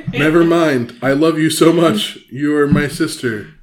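high, loud, hasty declaration; laughing backtrack. Pretend. Co-host learning it is recorded, loud laughing backtrack. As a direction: laugh and gush it loudly.

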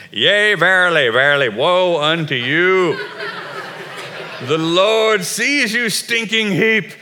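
put-on loud, thundering old-time prophet voice; mock biblical prophet. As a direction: boom it like a doom prophet. Preacher mocks condemning prophet.

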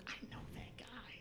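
unvoiced whisper of sudden recognition; whisper to self. Spotting an old acquaintance on the street, whispered. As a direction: breathe it to yourself, barely audible. Whispered thought on seeing him.

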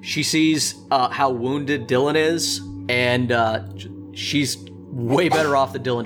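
ordinary talk, nothing marked

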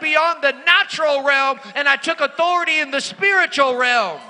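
full-force sermon delivery; preaching. Acted. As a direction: drive the lesson home to the church.

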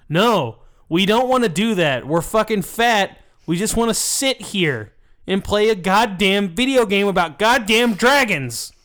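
mock-outraged couch gamer; fed-up rant. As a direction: protest indignantly, punching the key words. Acting fed-up gamers' angry reply.

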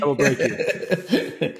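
slow villain impression through laughter; Ivan Drago. Pilot joking about his coach, slow laughing villain threat. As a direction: draw out the mock threat, amused. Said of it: "It was very much the Ivan Drago".

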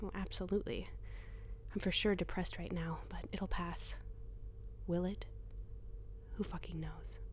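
hushed inner voice, close to the ear; a voice inside her head, whispering; whispered inner voice. Acted.

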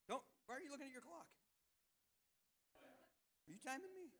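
quick, high, hushed playful jab; teasing mock accusation. Real, not acted. Preacher to congregation watching the clock, quick hushed teasing. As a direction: tease them for checking the time.

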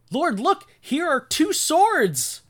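high, loud, slow hammed-up excitement; mock-triumphant, drawn-out squeal; overeager disciples. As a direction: present it proudly like a clueless follower. Acts clueless disciples showing swords.

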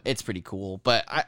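normal talking voice

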